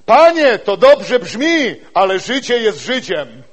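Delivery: loud, high, slow sceptic; sceptical Zechariah. Retold or imitated. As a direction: brush the promise off loudly. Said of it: "Co on mówi?". (głośne, sceptyczne „Panie” starego człowieka)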